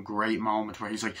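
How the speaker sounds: ordinary delivery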